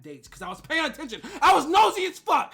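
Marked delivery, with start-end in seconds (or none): 1.4-2.5 high, very loud shout; shouted, shameless admission; loud confession. Real, not acted. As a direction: blurt it out with gusto. Gleefully admits she was nosy.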